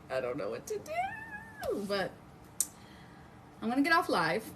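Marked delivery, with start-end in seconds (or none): 0.1-1.9 very high, soft squeaky panic; mock panic squeak. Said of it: "I'm like".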